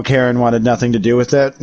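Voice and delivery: ordinary delivery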